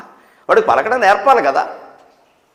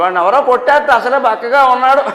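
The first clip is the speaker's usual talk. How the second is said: higher-pitched imitation of a complaining mother; protective mother; 6 semitones higher, 4 dB louder than usual. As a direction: plead the child's case as a fretting mother. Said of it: "తల్లి ప్రేమ కదా".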